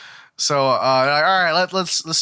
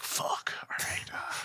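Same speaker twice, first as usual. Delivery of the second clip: a muttered whispered curse, giving up; deflated whisper; 14 dB quieter, slower than usual. Retold or imitated. Ozeki, after Ten-chan says she fears heights, whispering. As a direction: whisper it under your breath, plan ruined.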